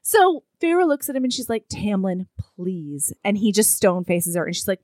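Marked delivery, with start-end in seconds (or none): 1.7-3.1 soft, low, hushed plea; acted pleading. Heroine begging her former lover for help, soft pleading. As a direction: beg gently, dropping almost to nothing.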